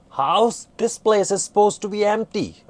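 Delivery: high, loud incredulous retort; indignant surprise. Acted. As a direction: protest the place was empty.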